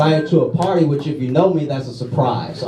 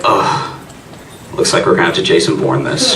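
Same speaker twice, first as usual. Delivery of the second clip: low tough-guy voice; mock action hero in his head; gruff action hero; 9 semitones lower than usual. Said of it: "I was like".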